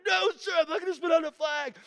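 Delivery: very high, loud retold refusal; defiant scream. Recruit refuses the yelling instructors, defiant scream. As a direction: scream back without giving an inch.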